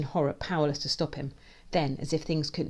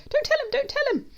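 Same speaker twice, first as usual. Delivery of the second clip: very high, loud frantic plea; panicked scream; 7 dB louder than usual. A narrator's reading. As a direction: scream it in desperate alarm. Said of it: "My brain screams".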